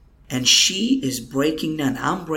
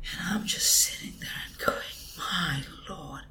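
choked whisper; 4 semitones higher, 6 dB quieter than usual. Real, not acted. Overwhelmed by Helen's confession.

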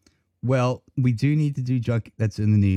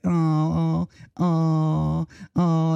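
higher cooing oh sounds; imitating the bird's aw; 4 semitones higher, much slower than usual. Mimicking the bird cooing with mom, higher. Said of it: "It's like".

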